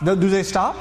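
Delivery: ordinary talk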